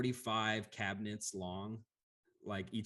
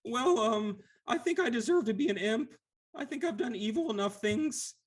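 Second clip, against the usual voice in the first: timid Lemure; 13 semitones higher, 5 dB louder than usual. Acted. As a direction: squeak it out like a weepy blob creature. GM voices Lemure.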